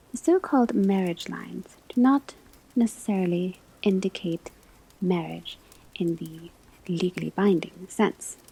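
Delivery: usual speaking voice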